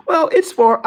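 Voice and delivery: mocking voice. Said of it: "just say".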